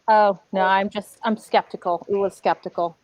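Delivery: ordinary delivery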